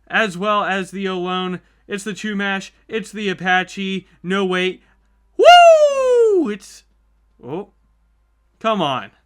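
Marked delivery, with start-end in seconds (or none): 5.4-6.5 a huge, high, joyful woo; victory whoop. Real, not acted. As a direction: let out a triumphant hoot. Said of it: "I was about to hoot and holler".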